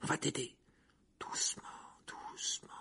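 coaxing whisper, very soft; murmuring. A narrator's reading. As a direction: whisper gently to coax along. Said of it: "murmura Harry". (chuchotement pour amadouer)

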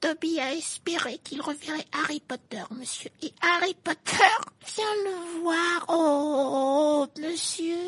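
very high elf voice, overjoyed; squeaky elf voice. A narrator's reading. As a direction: gush like a tiny grateful elf. Dobby's held voice.

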